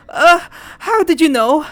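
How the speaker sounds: high, loud, startled question; alarmed. Acted. Leo realises his brother knows, alarmed. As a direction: blurt it out high, caught off guard.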